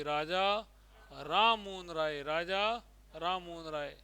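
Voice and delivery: Raja, then a pause, then राम Mohan राय as one name; pause after Raja. Shown as a demonstration. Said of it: "ऐसे बोलना चाहिए".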